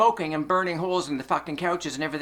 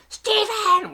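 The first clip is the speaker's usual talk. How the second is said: retold yell; 4 dB louder than usual. She chases him calling his name.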